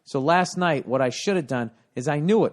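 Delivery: ordinary talk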